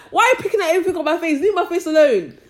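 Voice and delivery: high, loud and fast, fed up; laughing loud complaint; exasperated rant. Real, not acted. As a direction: blurt it out, fed up with nitpicking. Ranting at people who nitpick.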